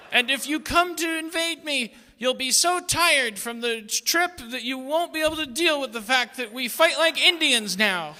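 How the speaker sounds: put-on defiant teen, lofty period phrasing; arch witty period comedy. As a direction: shout back like a rebellious teenager. Teen America defies parents.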